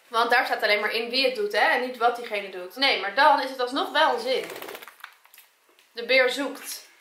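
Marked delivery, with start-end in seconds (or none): none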